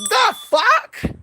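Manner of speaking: very high, played-up disbelief; incredulous. Reacting to auntie's behaviour with the admirer, high incredulity. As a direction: squeak it out as a baffled question.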